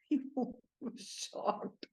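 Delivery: whispered through a laugh, very slow. Real, not acted. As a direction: let the laugh swallow the words.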